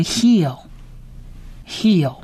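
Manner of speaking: heal as "hee" closing on an English L; English-like final L. Shown as a demonstration. Teacher adding lamed for learners, slow heal with final L. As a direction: sound out heal slowly, pausing between repeats. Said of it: "有点像是英文的L的音".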